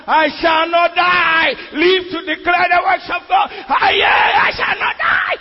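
shouting high and loud, rising to a cry; congregation shouting. Retold or imitated. The whole church shouting back at the preacher, loud excited cries. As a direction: shout it like an excited crowd claiming life. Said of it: "their strength will come".

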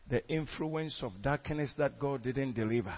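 usual speaking voice

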